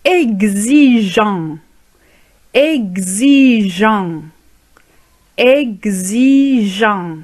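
exigeant stretched out very slowly, syllable by syllable; slow drill. Shown as a demonstration. Teacher slowly drilling students on exigeant. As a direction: draw out exigeant for learners to copy.

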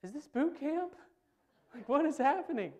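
put-on high astonished kid reaction; bewildered kid. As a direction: squeak out disbelief like a startled twelve-year-old. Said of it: "And I was like".

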